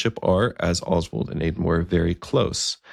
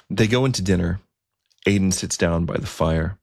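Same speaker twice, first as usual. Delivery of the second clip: ordinary delivery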